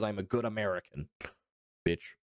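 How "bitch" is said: ordinary talk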